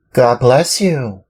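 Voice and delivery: put-on a polite little wooden boy; Pinocchio. Pinocchio again.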